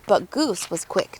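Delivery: ordinary delivery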